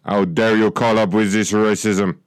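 mock outrage in a put-on accent; affronted weird-accented clerk; indignant scolding. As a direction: scold the caller in an odd foreign accent. Accented clerk keeps berating caller.

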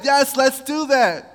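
thrilled king. Acted. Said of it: "thrilled, happy, excited".

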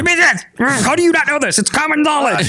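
very high, hammed-up scolding; mock exasperation. Mimicking the girl who fired off the answer, mock exasperated. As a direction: squeal it as an indignant know-it-all. Parodies the gym girl's aggressive answer.

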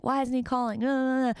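imitating an anxious, nagging self; whiny impatience. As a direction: fret in a needy, high-pitched whine. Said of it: "But before, I would be like".

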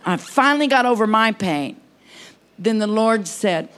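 usual speaking voice

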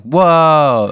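loud, high startled yell; freaked-out whoa. Acted. Player seeing dead Steve alive at home, loud startled yell. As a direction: shout it in shock. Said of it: "I'm like".

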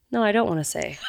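ordinary talk, nothing marked

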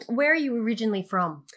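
normal talking voice

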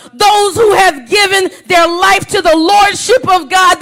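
full-force pulpit preaching. Acted. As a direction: drive the point home to the congregation.

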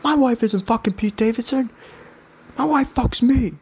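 mock the giant husband's outrage, pitch way up; imitating an angry guy. Huge husband confronting the podcaster, hammed-up high mock anger.

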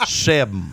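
seven said as "sebm", no V sound, back of the throat; Texan seven. Shown as a demonstration. Coach teaching the hosts a Texan seven as sebm. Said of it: "spelled S E B M ... There is no V".

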